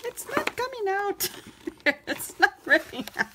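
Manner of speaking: high, laughing exasperation; frustrated laughing. Real, not acted. Crafter laughing when the page won't rip.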